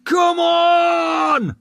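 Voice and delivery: high, loud, long battle scream; full-throated war cry. A narrator's reading. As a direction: scream it to rally the men. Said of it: "screamed Stour".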